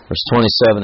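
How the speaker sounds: ordinary talk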